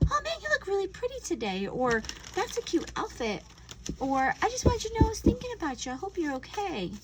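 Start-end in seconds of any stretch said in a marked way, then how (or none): 0.1-1.7 bright high gushing compliment, quick; sweet compliment. Acted. 2.4-3.4 sweet compliment. Acted. 4.4-6.9 bright high gushing compliment, quick; sweet compliment. Acted. Sweet message to a friend.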